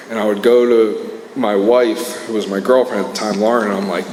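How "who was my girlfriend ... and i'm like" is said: normal talking voice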